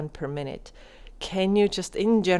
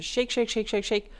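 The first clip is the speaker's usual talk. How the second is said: rhythmic shaking; 4 semitones higher than usual. Shown as a demonstration. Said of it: "Shake it out".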